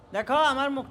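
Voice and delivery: ordinary delivery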